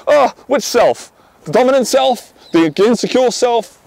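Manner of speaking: high, slow, drawn-out questioning; mock bafflement. Man answering a girl's "be yourself", mock bafflement.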